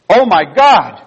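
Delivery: loud, high cry of shock; sudden exclamation. Retold or imitated. Pastor acting out the nurse's reaction, sudden exclamation. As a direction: blurt it out, astonished. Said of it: "said in my ear all of a sudden".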